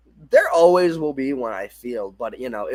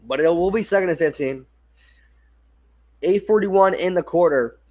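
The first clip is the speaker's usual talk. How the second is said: play-by-play call; 2 semitones higher than usual. Acted. Telling viewers the clock and the down, play-by-play. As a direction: set the snap like a live broadcast.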